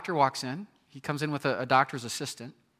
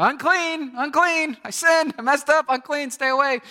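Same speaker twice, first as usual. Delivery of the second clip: much higher, loud outcast cry; shouting; 11 semitones higher, 7 dB louder than usual. Pretend. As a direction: call it out like a leper warning the street. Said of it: "shouting to people".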